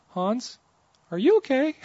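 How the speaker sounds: put-on high child's voice calling down; squeaky worried kid calling from the balcony; little girl's worried call. Little girl calls down to fallen preacher, high and worried. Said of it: "and she goes".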